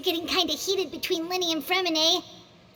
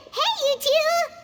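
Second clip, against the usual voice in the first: calling out; 9 semitones higher, 4 dB louder, slower than usual. Acted. Calling out to the two.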